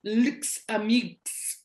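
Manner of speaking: "lx a mix" with each X sounded as a drawn-out KS; "lx a mix" ending in hard KS clusters; X endings pronounced. Shown as a demonstration. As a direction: say "lx a mix" slowly, hissing every X. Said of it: "en la situación de decir".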